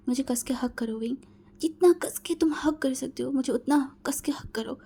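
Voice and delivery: pleading unvoiced whisper. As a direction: beg for the hug in a whisper. Whispered plea for a tight hug. (फुसफुसाती हुई विनती)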